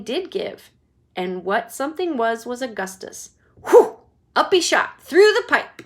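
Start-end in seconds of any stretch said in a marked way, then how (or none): 3.7-4.0 loud whooshing sound; loud storyteller whoosh. Acted. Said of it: "sound effect of the shot". 4.3-5.8 high, loud dramatic narration; excited narration. A narrator's reading.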